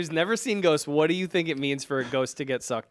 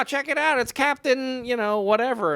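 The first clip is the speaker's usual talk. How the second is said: high squeaky voice mimicking a friend; squeaky falsetto mockery; 9 semitones higher than usual. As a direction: pitch way up, teasing falsetto. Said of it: "That's not what I sound like".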